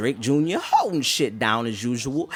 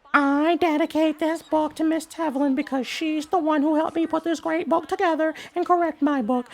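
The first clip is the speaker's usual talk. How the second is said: put-on high, breathless kid voice; high childish reading voice; eleven-year-old voice. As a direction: read it like an excited little kid. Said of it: "in my best eleven-year-old voice".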